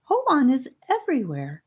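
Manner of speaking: a small boy who keeps insisting, voiced high; small boy's high voice. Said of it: "His voice was almost as high as Charlie's".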